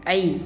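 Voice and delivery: "عي" sounded alone, ayn into an i; ayn with kasra. Shown as a demonstration. Teacher shows first graders the kasra sound, ayn with i on "عي". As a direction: say "عي" as ayn plus a short i vowel. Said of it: "تحتيها كسرة".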